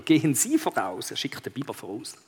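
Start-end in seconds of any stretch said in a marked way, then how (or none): none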